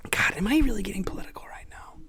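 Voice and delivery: hushed whisper to herself. Real, not acted. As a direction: whisper it, half-embarrassed. Catching herself getting political.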